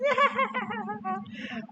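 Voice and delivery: very high imitated child giggle; soft, high-pitched put-on children's laugh; children's laughter. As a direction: giggle like a group of kids. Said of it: "in regular children sounding voices".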